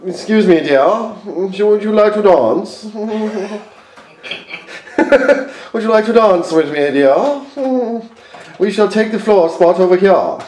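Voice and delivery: high, prim posh-English voice, laughing through it; a high, laughing posh English "excuse me, dear"; posh English accent. Pretend. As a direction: put on a snooty posh English lady. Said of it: "You say".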